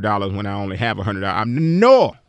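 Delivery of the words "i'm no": higher and louder, a firm no; emphatic no. Shown as a demonstration. Refusing to overspend, a higher, louder no. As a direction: slam down a final no. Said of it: "See how that feels?".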